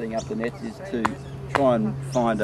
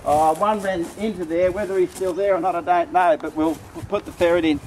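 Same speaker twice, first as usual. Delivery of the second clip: higher and louder, eager and a little slowed; excited; 8 semitones higher, 4 dB louder than usual. Real, not acted. A hunter spotting a rabbit bolt into the hole, excited. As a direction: pitch up with the thrill of the chase.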